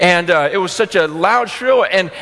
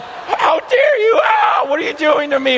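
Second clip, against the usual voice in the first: put-on outraged baby voice; 9 semitones higher than usual. Baby daughter wailing at her parents as if they hurt her, high crying shout. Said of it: "looks at us like we're doing it to her".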